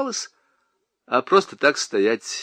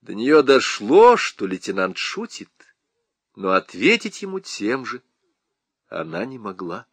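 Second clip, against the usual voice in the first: plain reading voice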